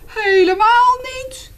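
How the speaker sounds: put-on very high, loud, slow child protest; protesting boy. Reading to children, the boy protests to the bear. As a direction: squeal it out as the boy. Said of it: "zei het jongetje".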